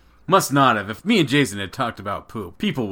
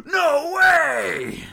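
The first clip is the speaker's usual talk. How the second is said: high, loud, drawn-out mock shout; hammed-up cry of disbelief; astonished outburst; 5 dB louder than usual. Dad retelling his library discovery, astonished.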